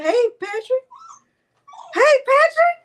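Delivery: very high, loud squealed greeting; excited calling out. Real, not acted. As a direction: sing out a delighted hello to a viewer. Calling out greeting to arriving viewer.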